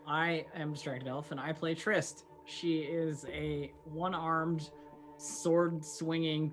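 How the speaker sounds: ordinary delivery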